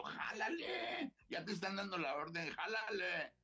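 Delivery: goading him to fire, half laughing; high mock dare at the gunman. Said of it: "Y yo".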